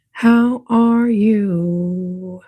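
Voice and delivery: slow, stretched "How are you?"; very slow drawn-out greeting. Pretend. Streamer greeting viewers in chat with a slow stretched drawl. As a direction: draw every word out long.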